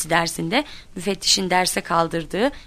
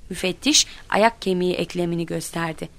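ordinary delivery

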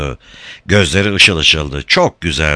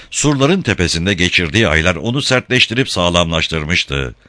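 usual speaking voice

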